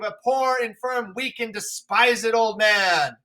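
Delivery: shout, then crack on the oh ho; wounded old man's shout at the sky; bitter raging king. Acted. Lear raging at the storm.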